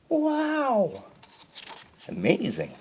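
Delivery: high amazed gasp; wow. A narrator's reading. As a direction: gasp at the magic. Marvel at magic.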